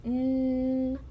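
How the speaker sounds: N as a long, level nasal hum; letter sound. Shown as a demonstration. Teacher demonstrates the new letter, letter sound. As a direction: hold N as a continuous nnn, not the letter name. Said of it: "make a noise through my nose".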